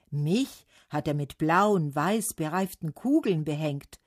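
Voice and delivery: ordinary delivery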